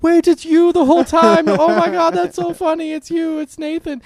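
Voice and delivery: very high, loud squealing silly voice; over-excited high-pitched impression of a character; giggly cartoon character. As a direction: act out a delighted cartoon friend. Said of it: "they just start giggling".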